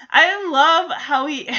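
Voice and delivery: usual speaking voice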